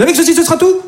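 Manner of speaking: high sing-song shop question; mock baker. Comedian playing the baker asking her stock question. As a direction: squeak it like the chirpy baker. Baker voiced in retold scene.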